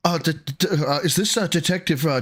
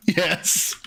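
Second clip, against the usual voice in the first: ordinary talk, nothing marked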